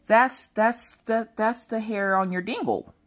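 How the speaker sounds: put-on high, slow child's voice; little girl. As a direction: imitate a small kid helpfully explaining. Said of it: "Maggie to the rescue. She's like".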